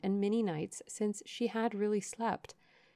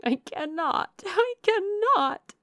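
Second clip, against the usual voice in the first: high, loud wail, drawn out the second time; despairing cry; 13 semitones higher, 7 dB louder, slower than usual. A narrator's reading. Grief-stricken sister told to exert herself, despairing cry. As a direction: wail it, climbing in pitch. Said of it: "cried Marianne".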